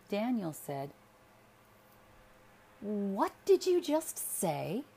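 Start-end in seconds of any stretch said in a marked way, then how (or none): 2.8-4.8 louder, slow, sharp demand; a husband turning on his wife with sudden anger. A narrator's reading. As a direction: snap the question out in sudden anger. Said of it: "with impromptu anger".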